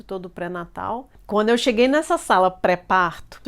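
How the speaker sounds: usual speaking voice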